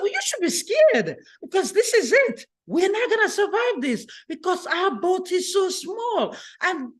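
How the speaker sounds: high pleading panic; frightened wife questioning her calm husband; scared wife. Acted. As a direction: beg him like she's sure they'll drown. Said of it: "She was so scared".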